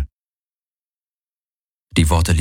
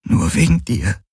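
higher, fragile and tearful; tearful confession; 4 semitones higher than usual. A narrator's reading. She repeats her confession to him, tearful. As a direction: let it out through tears. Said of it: "weitere Tränen liefen".

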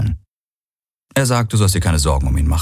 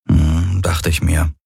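ordinary delivery, nothing marked

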